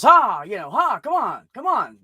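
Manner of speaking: high, loud coaxing of a stubborn camel; hup, come on, urging it forward. Retold or imitated. Man coaxing his stuck camel, high and pushy.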